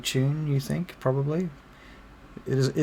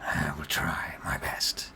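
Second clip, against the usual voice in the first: slow, low, whispery grumble; hushed, drawn-out in-character reply; reluctant goblin bard; 7 semitones lower, 7 dB quieter, slower than usual. Acted. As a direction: agree grudgingly, almost under the breath. Bard reluctantly agrees to play cheerfully.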